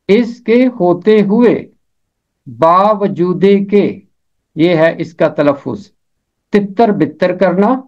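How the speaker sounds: ordinary talk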